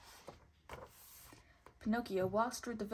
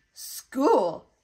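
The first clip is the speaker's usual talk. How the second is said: loud, scornful one-word sneer; mock disdain, louder; 4 semitones higher, 11 dB louder than usual. A narrator's reading. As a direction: sneer at the idea of school. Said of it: "sneered the fox".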